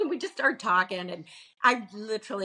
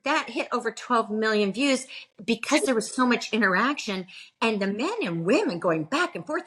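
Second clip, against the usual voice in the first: ordinary talk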